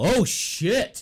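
high, loud alarmed curse; sudden high startled swear; shocked curse. Retold or imitated. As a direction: blurt it out in shock. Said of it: "I go".

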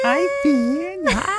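put-on very high squeaky little-kid voice; dad echoing his toddler, very high. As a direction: pipe it up like a tiny boy. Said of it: "echoing toddler".

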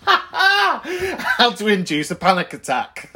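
slow, laughing through the words. Real, not acted. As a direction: giggle it out slowly. Laughing at own topic.

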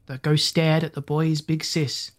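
usual speaking voice

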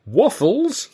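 high, loud call for attention; shouting. A narrator's reading. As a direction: call out to catch someone's attention. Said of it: "I shouted".

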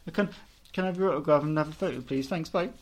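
quick, mock-nervous rush; flustered fan request. Retelling asking a star for an autograph, in a flustered rush. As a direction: rattle it off and flee. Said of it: "I was just like".

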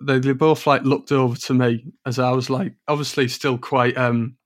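normal talking voice

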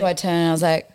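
usual speaking voice

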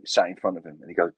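ordinary talk, nothing marked

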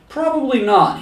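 high-pitched little devil voice; fortune teller devil. Retold or imitated. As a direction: answer high, as a little fortune-telling machine. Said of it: "fortune teller devil".

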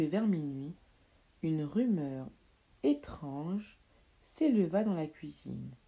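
ordinary delivery

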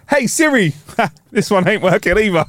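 mocking Kay. Teasing Kay about asking Siri for the time, in a high laughing voice. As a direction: tease him like he's begging his Siri. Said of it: "Kay's there going".

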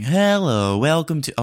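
high, loud chirpy welcome; drive-thru bot greeting. Acted. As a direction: restart cheerfully like a machine.

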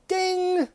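high sung ding; mock game show ding; ding chime. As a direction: imitate the correct-answer bell.

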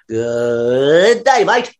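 slowed-record Aussie drawl rising at the end; Australian accent. Shown as a demonstration. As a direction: imitate Australian like a record winding up from slow. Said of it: "almost like slowing down a record".

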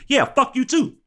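high, cocky brush-off. Retold or imitated. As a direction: toss it back with swagger. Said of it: "in the words of [name]".

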